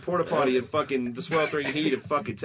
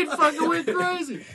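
ordinary talk, nothing marked

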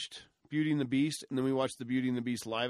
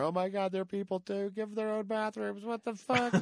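higher, mock-outraged whine; mocking whiny voice; 7 semitones higher than usual. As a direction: parody a shocked activist. Mocking imagined alien-rights crowd.

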